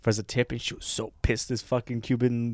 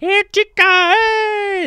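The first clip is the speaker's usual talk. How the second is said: much higher, louder catcalling man; street catcall; 8 dB louder, much slower than usual. Retold or imitated. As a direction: holler it down the strip like a creep.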